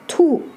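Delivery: "tu" falling sharply from high; fourth tone. Shown as a demonstration. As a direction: drop "tu" briskly from the top. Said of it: "but have different tones".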